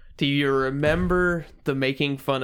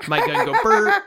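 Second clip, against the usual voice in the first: laughing; 6 dB louder than usual. Pretend. Gun noise mockery, laughing.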